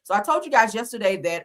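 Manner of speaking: normal talking voice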